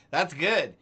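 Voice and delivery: loud, laughing reply. Real, not acted. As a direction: say it through laughter.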